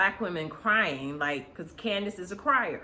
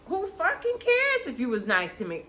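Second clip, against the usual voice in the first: high-pitched scornful mimicry; imitating Mia's dismissal; 7 semitones higher than usual. Mia brushing off her castmate's kindness, mock scornful. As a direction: throw it back high and dismissive. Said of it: "Mia says".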